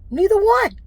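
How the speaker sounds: high, loud indignant cry; indignant outburst. Real, not acted. Telling viewers about her clean record, indignant and loud. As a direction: stress NEITHER ONE in protest. Indignant emphasis, big jump.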